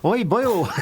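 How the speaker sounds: high, sing-song mock Welsh; comic Welsh lilt, pitched well up; hammed-up Welsh accent for laughs. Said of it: "I'll do the Welsh accent 'cause it's funny".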